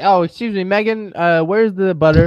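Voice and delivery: high, sugary friendly customer voice; overly friendly shopper. Retold or imitated. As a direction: sweetly call the clerk by name. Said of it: "I'd just be like".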